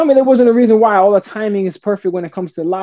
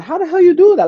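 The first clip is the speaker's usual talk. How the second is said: amazed disbelief; 14 semitones higher, 3 dB louder than usual. Retold or imitated. Amazed at Facebook call.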